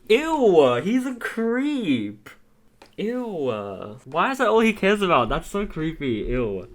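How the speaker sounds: high, loud, stretched-out ew of disgust; disgusted squeal. Real, not acted. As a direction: recoil in a shrill cry of disgust. Disgusted reaction to creepy caller.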